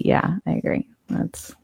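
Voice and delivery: breathy whispered aside. Real, not acted. Co-host muttering agreement in a whisper. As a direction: drop to a whisper, half to herself.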